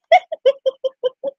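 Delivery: high-pitched giggle in short bursts; laugh. Real, not acted. Amused by a viewer in the chat, high giggle. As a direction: giggle high, in a quick run.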